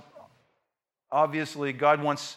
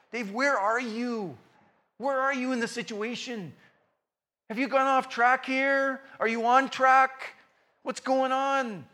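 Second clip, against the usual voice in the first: higher, slow questioning voice; God's gentle call; 8 semitones higher, 4 dB louder than usual. Retold or imitated. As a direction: ask slowly, searching. God's voice calling him.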